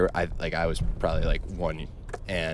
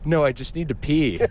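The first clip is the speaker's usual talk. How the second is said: put-on higher babysitter voice; 8 semitones higher, 5 dB louder than usual. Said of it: "And the babysitter goes".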